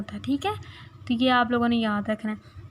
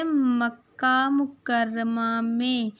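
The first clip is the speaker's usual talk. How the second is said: very drawn-out answer; slow model answer for repeating; much slower than usual. Shown as a demonstration. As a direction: stretch each word for the class. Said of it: "आप सल्लम कहाँ पैदा हुए?".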